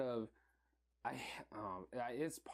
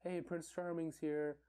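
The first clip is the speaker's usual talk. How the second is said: quoted movie character; 6 semitones higher than usual. Retold or imitated. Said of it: "it was towards the beginning when".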